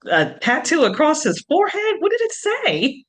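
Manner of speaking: incredulous. Real, not acted. Friend's ex had forehead tattoo.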